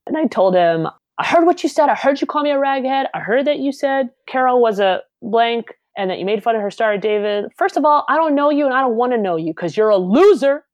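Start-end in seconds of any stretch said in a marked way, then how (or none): 1.2-10.7 high-pitched retold telling-off; accusing tirade. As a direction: lay out the charges to the bully's face. Retells telling off the bully.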